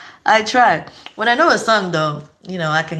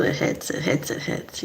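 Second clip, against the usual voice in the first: whisper; 7 semitones lower, 7 dB quieter than usual. Real, not acted. Savoring the food.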